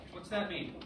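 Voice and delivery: usual speaking voice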